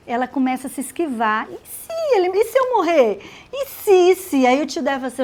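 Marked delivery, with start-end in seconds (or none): 1.5-4.5 high, fretful worried voice; anxious Esther. Acted. Preacher voicing Esther, afraid of dying, in a high fretful voice. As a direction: Esther dithering, afraid she'll die. Esther fears going to the king.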